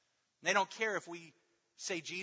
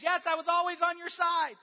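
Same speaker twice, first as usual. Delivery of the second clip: high, loud, sing-song fake loyalty; squeaky mock turncoat announcing he was always loyal; 17 dB louder than usual. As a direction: ham up the late convert's eager claim. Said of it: "The guy who waits around ... then says".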